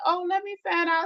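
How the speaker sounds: high sly "oh, let me find out"; nosy neighbor teasing. Retold or imitated. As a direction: tease like a gossiping neighbor. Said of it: "She was like".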